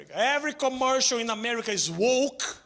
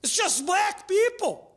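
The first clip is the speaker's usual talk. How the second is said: high, whiny mock complaint, pitched up; 7 semitones higher than usual. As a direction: play the bar guy ranting at the TV, high and whiny. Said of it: "The guy was screaming".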